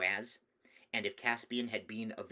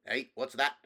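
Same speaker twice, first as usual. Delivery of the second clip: sharp; 3 semitones higher, 6 dB louder than usual. A narrator's reading. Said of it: "gave Caspian a very sharp look".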